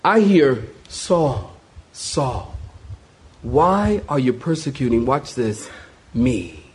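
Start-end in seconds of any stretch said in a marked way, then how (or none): 0.9-5.1 soft, low, grieving call; hushed sorrow, names let fall gently; broken-hearted father. Acted. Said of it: "the heart of a broken father". 6.1-6.6 very low, softened, wounded word; hushed, grieving single word; broken-hearted father. Acted. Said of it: "the heart of a broken father".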